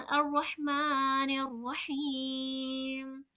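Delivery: slow, drawn-out Quranic recitation of अर्रहमानिर्रहीम with full रा. Shown as a demonstration. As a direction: chant अर्रहमानिर्रहीम slowly, both रा heavy. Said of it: "दोनों रा को पुर पढ़ेंगे".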